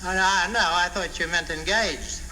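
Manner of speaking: normal talking voice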